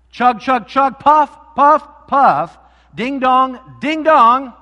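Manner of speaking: loud, high chugging and ding-donging; train noises. Acted. Pastor playing the train for the congregation, loud and high. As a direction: call out the little train's sounds. Train sounds to open story.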